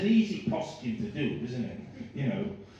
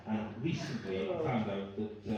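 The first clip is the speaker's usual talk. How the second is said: normal talking voice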